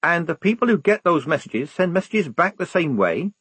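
lightly acted, insistent recruit; lightly voiced eager soldier. Figure in narrated story.

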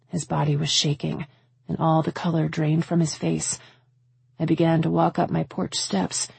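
ordinary delivery, nothing marked

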